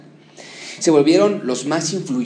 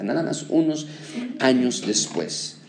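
usual speaking voice

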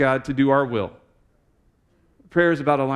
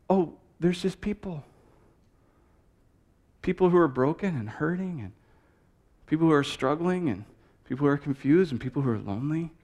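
tender; 6 dB quieter than usual. Real, not acted. Tender sermon climax.

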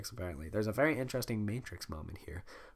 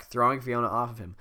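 ordinary delivery

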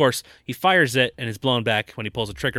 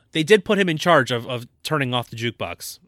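normal talking voice

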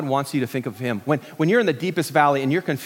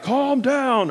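high, drawn-out mock command; imitated paramedic; 8 semitones higher than usual. Paramedics to panicking wife, high put-on voice.

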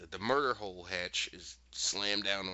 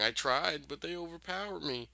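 higher, mock whimpering voice; imitated whimper; 8 semitones higher than usual. Hurt Miranda excusing herself, imitated whimper. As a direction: whimper it lightly, pitched up in excuse. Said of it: "Miranda's still whimpering".

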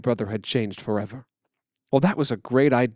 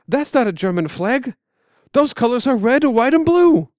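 rising higher and louder to the end; growing excitement; 10 semitones higher, 5 dB louder than usual. Acted. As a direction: build joy as you name the colors. Boy excitedly spotting flag.

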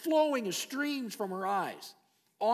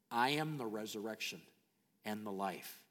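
hushed Jesus quote; 11 semitones lower, 8 dB quieter than usual. Acted. Voicing Jesus' words, hushed.

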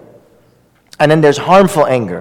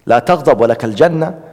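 rapid recitation; 3 semitones lower, much faster than usual. Real, not acted. Quoting hadith in Arabic.

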